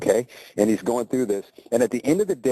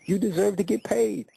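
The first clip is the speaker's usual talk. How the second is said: You deserve to get paid pitched much higher, pleading; insistent repeat; 11 semitones higher than usual. Retold or imitated. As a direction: lift the whole repeat up high to press her point.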